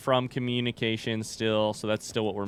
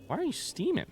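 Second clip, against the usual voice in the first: high mock question; 6 dB quieter than usual. Real, not acted. Talking to steaming pipes.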